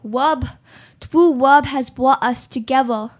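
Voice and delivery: put-on slow lisping old priest; drawn-out "twoo wub" lisp; Princess Bride priest. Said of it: "He's like".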